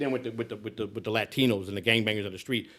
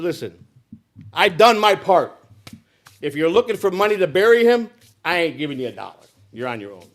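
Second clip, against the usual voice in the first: retold angry refusal; 3 semitones higher, 6 dB louder than usual. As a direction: slam the door on paying for the burial. Refuses sister burial money.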